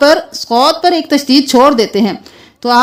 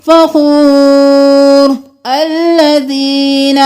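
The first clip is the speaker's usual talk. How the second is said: pausal recitation; 2 semitones higher, much slower than usual. Shown as a demonstration. Teacher contrasts stopping with joining. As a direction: stop after فخور, then restart on الذین. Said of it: "اگر وقف کر دیا تو".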